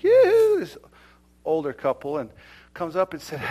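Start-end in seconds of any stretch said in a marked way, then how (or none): 0.0-0.6 high, loud yoo-hoo call. Retold or imitated. Older woman hailing a man on the beach, calling out. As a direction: sing out to get someone's attention.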